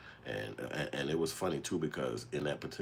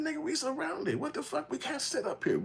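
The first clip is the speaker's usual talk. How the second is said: very high, urgent mock yelp; high-pitched retold teenage alarm; panicked teen. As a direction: insist they can't just sit there. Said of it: "I'm saying".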